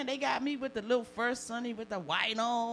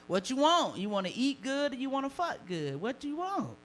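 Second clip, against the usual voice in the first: teasing challenge; 6 semitones higher, 4 dB louder than usual. Acted. Rhetorical challenge to audience.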